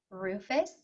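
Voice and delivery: ordinary talk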